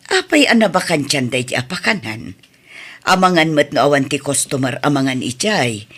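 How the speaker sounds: ordinary talk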